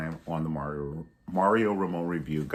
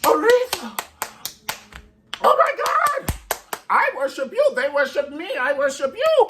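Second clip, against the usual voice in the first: hammed-up adoring fan; mock fangirl squeal; 5 dB louder than usual. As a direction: squeal in worshipful awe. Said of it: "she was like".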